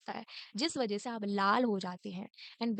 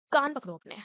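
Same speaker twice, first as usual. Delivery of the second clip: higher, louder teacher command; mock stern order; 4 semitones higher, 7 dB louder than usual. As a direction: snap the punishment order. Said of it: "तो फिर बोलती हैं".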